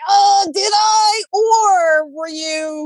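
high, slow, sarcastic challenge; Gen X sass. Retold or imitated. Gen Xer mocking a partner's blame, slow sarcastic challenge. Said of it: "as a Gen Xer, I'm like".

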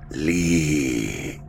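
low, ghostly whispered voice; eerie whisper. A narrator's reading. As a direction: breathe it like a spirit in his ear. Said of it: "the same voice whispered".

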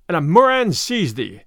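ordinary delivery, nothing marked

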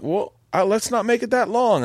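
high Southern mother-in-law retort; Southern accent. Retold or imitated. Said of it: "have a Southern accent".

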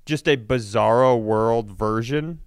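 ordinary talk, nothing marked

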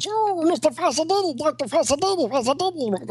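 put-on high, breathless girlish cries; mock fleeing nurse calling the doctor; girlish Annette voice. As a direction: squeal his name as she's chased. Annette voiced chasing scene.

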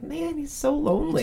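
high, pitying little lament; mock pity. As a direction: feel sorry for the lonely villain. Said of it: "I'm just sitting there like".

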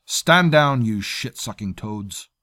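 loud, high barked command; barked order. A narrator's reading. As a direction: bark them to attention. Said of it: "Another voice barked".

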